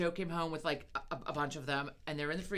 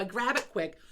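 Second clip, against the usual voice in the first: ordinary talk, nothing marked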